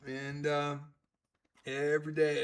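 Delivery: normal talking voice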